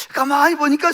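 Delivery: normal talking voice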